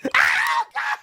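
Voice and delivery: high shriek of disbelief, laughing; shocked shriek. Real, not acted. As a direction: scream in real shock. Shock at game reveal.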